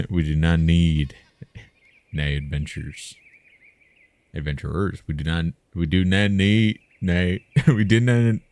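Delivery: playful over-the-top declaiming; hammed-up declaration. Playful hammed-up repeats of a line.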